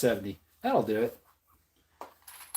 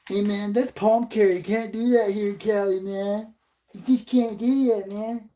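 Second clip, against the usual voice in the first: high, put-on pleading voice; hammed-up high-voiced objection; mock Californian objector; 7 semitones higher than usual. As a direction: plead like a pitched-up killjoy. Acting someone objecting in Cali.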